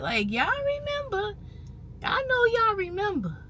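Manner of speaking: knowing high ask. Real, not acted. Asking viewers to recall.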